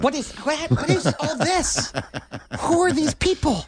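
high squeaky confused stomach voice; high, puzzled little stomach voice. Retold or imitated. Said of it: "Your stomach right now is like".

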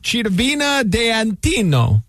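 mock Italian pronunciation of the town name; hammed-up Italian accent; fake Italian accent. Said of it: "That's my fake Italian".

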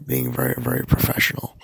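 breathy, straining after surgery; weak after surgery. Real, not acted. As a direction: push words through a tender throat. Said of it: "I don't sound better".

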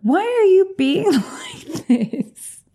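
high, drawn-out laughing complaint; laughing exasperation. Real, not acted. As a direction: whine slowly through a laugh.

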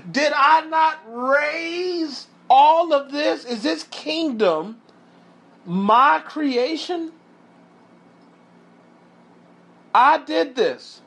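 high, slow, powerful royal boast; Nebuchadnezzar exclaiming. Acted. Preacher as the king claiming his kingdom, exclaiming powerfully. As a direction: exclaim it grandly, stretching the big words. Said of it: "exclaimed is powerful".

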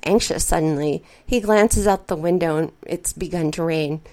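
plain reading voice

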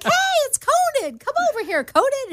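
high, excited street shout-out; guys calling out to Conan. Retold or imitated. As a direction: call to him across the street like a fan. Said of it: "And guys are like".

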